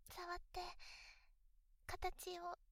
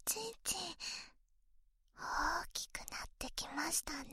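ear whisper; 4 semitones lower, 5 dB louder than usual. Acted.

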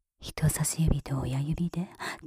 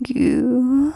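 loud squeeze sound; 6 semitones higher, 8 dB louder than usual. Acted. Squeeze sound, soft then loud.

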